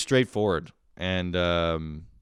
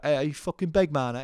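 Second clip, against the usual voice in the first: higher mock Cockney comeback; Cockney accent; 7 semitones higher than usual. Homie joining the back-and-forth, mock Cockney. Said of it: "the Cockney accent".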